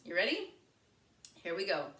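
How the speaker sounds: ordinary talk, nothing marked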